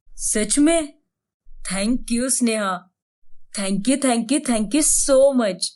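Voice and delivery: low male voice, relieved thanks; grateful husband. A narrator's reading.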